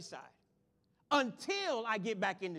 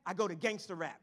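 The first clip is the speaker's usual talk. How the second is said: ordinary talk, nothing marked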